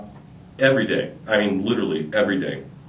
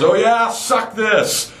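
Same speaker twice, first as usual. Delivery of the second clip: mock yell; 10 semitones higher, much slower than usual. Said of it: "another student yells".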